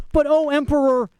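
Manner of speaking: high-pitched imploring appeal; pleading. A narrator's reading. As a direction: beg the ruler in a high voice. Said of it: "pleaded the flyer".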